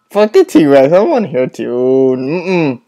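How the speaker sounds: ordinary delivery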